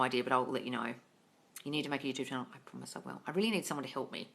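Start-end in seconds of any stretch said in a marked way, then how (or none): 2.5-3.2 whisper. Real, not acted. Promising viewers a YouTube channel.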